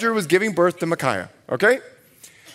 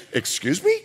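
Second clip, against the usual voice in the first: mock offended; 5 semitones higher, 3 dB quieter than usual.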